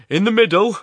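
loud, high bellow over the furnace noise; bawling. A narrator's reading. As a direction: bawl it over the roar. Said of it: "bawled Horrocks".

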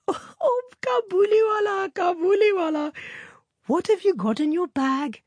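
put-on very high, piping child voice; merry little girl. A narrator's reading. Minnie teasing her giant friend about his bag, merry. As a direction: call out high and playful like a small girl. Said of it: "ripple her face with laughter and begin".

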